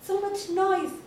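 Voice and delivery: usual speaking voice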